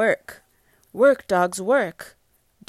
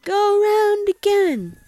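yelling dog; 14 semitones higher, 6 dB louder than usual. Acted. Parent voices the yelling dog for child, high loud yell. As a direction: holler it way up high like an excited storybook dog. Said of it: "there's one dog yelling".

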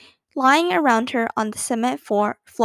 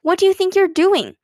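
higher, louder scolding question; exclaiming; 6 semitones higher, 5 dB louder than usual. A narrator's reading. Older sister to the sister she found hiding, exasperated exclaiming. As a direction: demand an explanation from a naughty child. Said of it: "exclaimed Beezus when she had found her voice".

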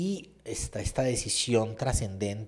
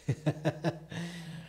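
light laugh; 7 semitones higher, 5 dB quieter than usual. Real, not acted. Laughs after mocking quote.

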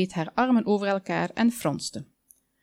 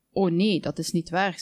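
ordinary delivery, nothing marked

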